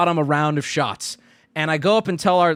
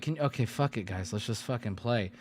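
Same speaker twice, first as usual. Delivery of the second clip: low, much softer fed-up mutter; exasperated mutter; 7 semitones lower, 11 dB quieter than usual. Retold or imitated. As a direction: give up under your breath. Giving up on politeness.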